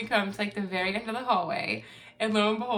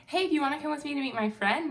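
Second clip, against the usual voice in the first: lightly imitated youth, higher and quick; a quick, higher-pitched mock invite; youth's invitation; 7 semitones higher, faster than usual. Youth inviting a newcomer to meet a friend, lightly imitated.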